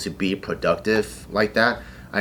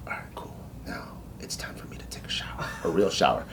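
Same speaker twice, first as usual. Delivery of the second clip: barely voiced, secretive murmur; hushed sneaking whisper; 6 semitones lower, 12 dB quieter, faster than usual. Recruit to himself before a secret night shower, whispered. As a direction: whisper it like everyone is asleep.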